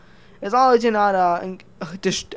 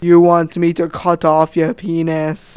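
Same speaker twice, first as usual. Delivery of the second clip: slow, loud, lower incredulous dictator; Kim Jong-un impression; 3 semitones lower, 5 dB louder, slower than usual. Kim Jong-un slowly and loudly questions the citizen. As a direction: drag it out in disbelief. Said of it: "Kim Jong-un would say".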